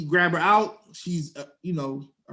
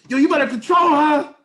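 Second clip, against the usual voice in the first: loud, high mimicked shout; guys yelling across street; 8 semitones higher, 7 dB louder than usual. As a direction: holler it like a heckler across the road. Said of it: "heard from the guys across the street".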